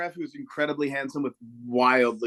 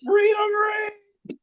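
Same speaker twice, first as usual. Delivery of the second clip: much higher, shouting, ending in a whispered cry; patriotic heckle; 5 dB louder, slower than usual. Retold or imitated. Fan heckling the English goalie as an eagle flies over, rising shout. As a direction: build from taunt to ecstatic patriotic yell. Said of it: "And I said".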